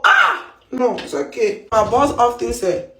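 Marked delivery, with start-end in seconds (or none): 0.0-0.5 sudden high-pitched frustrated yelp. Real, not acted. Stuck on a medical word, a sudden high yelp. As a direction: let out a sharp, very high cry of frustration.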